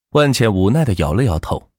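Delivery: usual speaking voice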